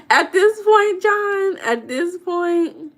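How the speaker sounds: fake-sob the complaint for laughs; mock crying. Complaining to John about her cursed luck, a hammed-up mock cry.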